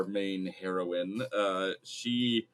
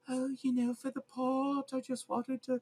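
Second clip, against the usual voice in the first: put-on high, airy British accent far above the speaker's own; wispy English voice. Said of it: "wispy English voice".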